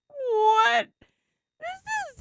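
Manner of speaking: loud, high-pitched cry of disbelief; real stunned squawk; astonished. Real, not acted. Astonished reading about chicken console.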